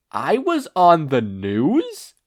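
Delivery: loud, slow, disbelieving question; stunned he made the news; astonished. A narrator's reading. Izuku hearing from his mom, loud stunned question.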